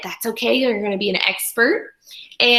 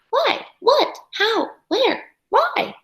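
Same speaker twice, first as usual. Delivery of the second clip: put-on curious child; 13 semitones higher, slower than usual. Voicing child's flurry of questions.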